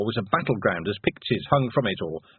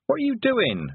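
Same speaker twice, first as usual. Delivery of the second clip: put-on young girl voice; 13 semitones higher than usual. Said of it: "said Tiffany".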